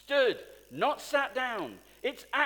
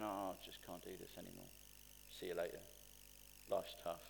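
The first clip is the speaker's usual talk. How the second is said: much softer and lower, giving up; defeated mutter; 12 semitones lower, 14 dB quieter, faster than usual. Retold or imitated. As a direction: sigh it out like someone ready to quit. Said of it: "you walk out of here going".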